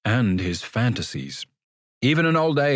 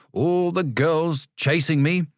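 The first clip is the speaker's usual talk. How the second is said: mocking echo; 7 semitones higher, slower than usual. A narrator's reading. Narrator echoes Sam mockingly.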